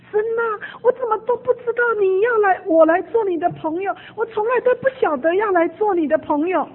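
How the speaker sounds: high, loud, tearful cry of prayer; crying out to God. Retold or imitated. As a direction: pour it out, voice breaking in tears. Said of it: "就跪在那里跟主大喊".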